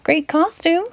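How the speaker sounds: mock ghoul shriek. Ghoul shrieking praise at the alien's costume, mock shriek. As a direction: squeal it out like a cheery ghoul. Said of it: "shrieks a ghoul".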